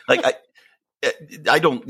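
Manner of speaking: normal talking voice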